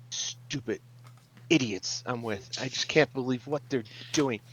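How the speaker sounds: soft, private grumble; mumbling under her breath. Acted. Healer muttering about her companions while wiping a forehead, mumbled. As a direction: grumble quietly to yourself. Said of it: "starts mumbling under her breath".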